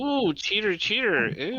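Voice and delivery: teasing cheater, cheater; singsong scolding of a cheater; mock disgust. Real, not acted. Gossip about cheating actor.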